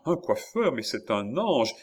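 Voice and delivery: high start, joyful amazement; rapturous exclamation. A narrator's reading. Countess praising the hairdresser, exclaiming. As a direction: marvel at a godsend. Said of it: "en levant les mains au ciel".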